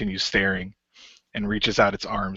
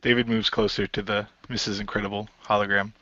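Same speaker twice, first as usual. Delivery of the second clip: normal talking voice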